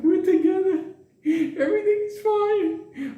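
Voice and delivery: put-on very high, drawn-out old-lady voice; hammed-up old woman cooing; blissful old lady. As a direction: imitate her high and slowly. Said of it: "when she was like".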